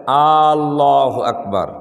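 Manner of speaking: "আল্লাহু আকবার" slow, with the first a of "আল্লাহু" stretched long; drawn-out Allahu Akbar. Shown as a demonstration. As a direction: stretch the opening a of "আল্লাহু" as a wrong demo. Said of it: "একটা উচ্চারণ হলো যদি কেউ এরকম বলে".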